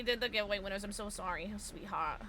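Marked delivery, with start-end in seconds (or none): none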